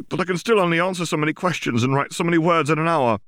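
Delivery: high, fast and loud, words tumbling out; frantic. A narrator's reading. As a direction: rush it in a rising panic. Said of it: "she said frantically".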